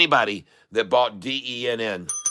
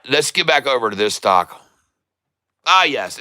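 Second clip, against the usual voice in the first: normal talking voice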